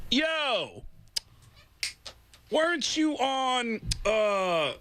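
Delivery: high, slow, drawn-out fan impression; stretched-out, high-pitched call of recognition; retold excited fan. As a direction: imitate a stranger slowly recognizing someone. Retold stranger recognizing him, drawn out.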